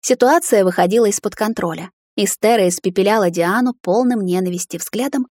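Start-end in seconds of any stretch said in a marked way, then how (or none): none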